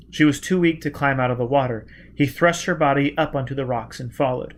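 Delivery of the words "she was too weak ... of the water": usual speaking voice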